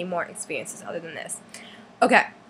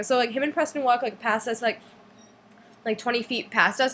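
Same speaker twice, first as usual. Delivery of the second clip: ordinary delivery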